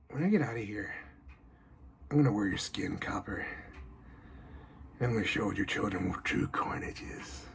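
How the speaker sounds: low, breathy killer threat; menacing whisper. Acted. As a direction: whisper the threat into his ear. Prisoner threatens the guard.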